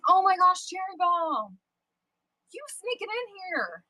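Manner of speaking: high squeal of delight at a friend; loud, high happy welcome; excited greeting. Real, not acted. Streamer squealing at a friend sneaking in, delighted.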